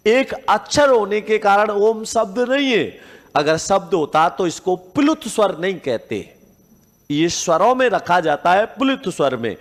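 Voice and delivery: ordinary delivery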